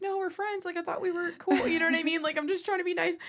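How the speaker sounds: high-pitched mock protest; acted-out defensive squeal; defensive pleading. Acting out her defensive plea to him.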